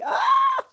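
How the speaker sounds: very high, loud squeal of delight; excited shriek. Real, not acted. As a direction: burst out squealing. Reacts to chat news.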